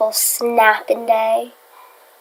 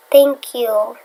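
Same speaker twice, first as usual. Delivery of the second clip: usual speaking voice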